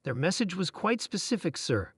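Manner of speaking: ordinary delivery, nothing marked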